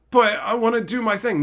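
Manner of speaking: high-pitched, put-on complaint; mock whiny protest. Imitating a player denied their turn, mock whining. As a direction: plead like a thwarted player.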